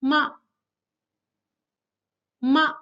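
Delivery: "Ma" very short; neutral tone. Shown as a demonstration. Teacher reads for students to repeat, "Ma" short and quick. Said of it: "after I read each tone twice".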